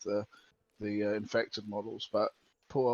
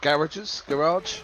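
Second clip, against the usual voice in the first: loud; 5 semitones higher, 6 dB louder, slower than usual. Real, not acted. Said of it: "search the what?".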